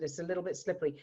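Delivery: ordinary talk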